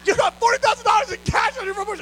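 high, loud incredulous shout; stunned disbelief. Retold or imitated. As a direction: blurt it out in total disbelief. Contractor stunned by cash.

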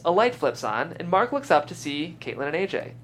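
normal talking voice

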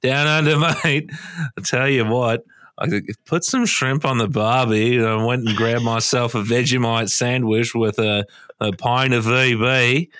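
hammed-up Aussie yarn-spinner accent; Australian accent. Co-host spinning a mock night-out yarn, Australian accent. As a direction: tell it as a broad Australian tall tale. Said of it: "really good Australian accent".

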